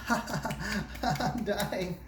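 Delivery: high, hammed-up dying cry with a laugh; laughing, over-the-top fake death; mock dramatic dying. Shown as a demonstration. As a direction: overplay the death like a soap opera. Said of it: "we don't die like this".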